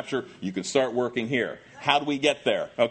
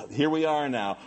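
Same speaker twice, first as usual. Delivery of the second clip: ordinary talk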